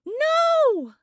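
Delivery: piercing high scream; terrified half-awake scream. Acted. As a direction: scream it right in his face. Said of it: "she screamed again".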